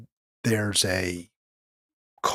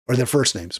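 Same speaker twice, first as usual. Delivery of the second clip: usual speaking voice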